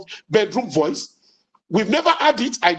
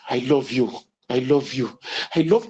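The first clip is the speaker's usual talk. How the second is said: much lower, intimate I love you; tender bedroom voice; 10 semitones lower than usual. Shown as a demonstration. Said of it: "the voice with which you say it".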